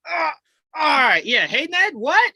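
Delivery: high, loud comic imitation of Tom answering a call; mock Tom on the phone. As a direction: play it as a flustered kid picking up the phone. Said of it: "where he's just like".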